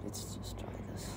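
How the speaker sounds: whispering to himself; whispered aside. Real, not acted. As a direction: whisper it under your breath. Aside while fixing connection.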